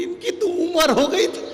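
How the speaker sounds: wife frantically backing out; alarmed shriek. Retold or imitated. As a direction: blurt it out in panic, shrill and high. Wife changes her mind in alarm.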